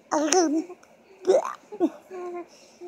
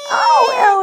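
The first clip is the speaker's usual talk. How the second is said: excited oh; 7 semitones higher, 7 dB louder than usual. Real, not acted. Reacting to the baby.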